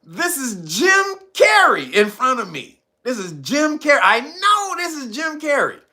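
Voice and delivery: high, mock-incredulous protest; exasperated disbelief. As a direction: retell it as a man insisting on the obvious. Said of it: "And he said".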